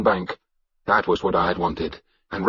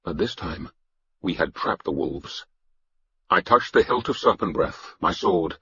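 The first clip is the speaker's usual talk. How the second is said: plain reading voice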